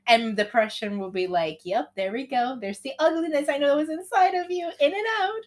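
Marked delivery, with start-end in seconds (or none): 1.6-5.4 gloating. Acted. Depression gloats in acted story.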